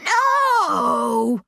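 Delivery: high, slow, anguished wail; drawn-out high cry of distress; old man's wail. A narrator's reading. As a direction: wail in protest, stretching the words. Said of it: "one old man wails".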